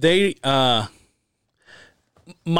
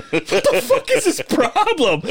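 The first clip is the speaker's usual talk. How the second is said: baffled; 10 semitones higher, slower than usual. Retold or imitated. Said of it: "looking at him like".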